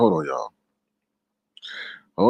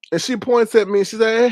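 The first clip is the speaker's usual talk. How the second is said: usual speaking voice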